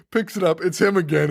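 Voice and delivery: ordinary talk, nothing marked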